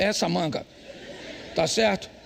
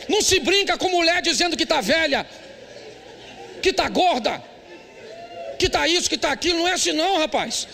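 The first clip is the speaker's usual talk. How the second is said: fiery preaching; 8 semitones higher than usual. Acted. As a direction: preach it hard to the husbands. Preaching at full force.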